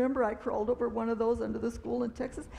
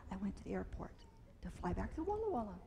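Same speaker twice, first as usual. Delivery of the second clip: whisper; 3 semitones lower, 12 dB quieter, faster than usual. Real, not acted. Quiet aside about the trip.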